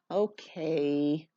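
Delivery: soft, low, mumbled okay; barely bother to answer. Acted. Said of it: "Ruthie mumbled".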